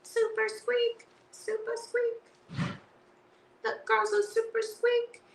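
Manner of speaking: high, slow, soft squeak; a tiny squeaky toy voice, drawn out; super squeaky voice. Pretend. Voicing the card character in a high squeak. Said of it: "Super squeaky".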